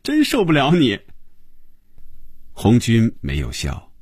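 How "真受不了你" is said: high, laughing exasperation; amused, giggly. A narrator's reading. Phoebe giving up on Hongjun's silliness, amused. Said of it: "菲比一下子笑了起来".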